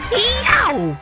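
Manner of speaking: sudden high yelp of pain; Hare's startled yelp. Acted. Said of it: "Hare cried".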